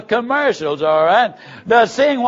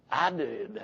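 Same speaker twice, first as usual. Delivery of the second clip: much softer and lower, barely owning up; timid; 9 semitones lower, 12 dB quieter than usual. Retold or imitated. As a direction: confess in a small, sheepish voice. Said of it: "this guy very timidly said".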